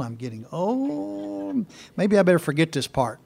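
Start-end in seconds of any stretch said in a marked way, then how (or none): 0.0-1.6 mock lament. Real, not acted. Preacher joking about forty-one years in ministry, mock lament.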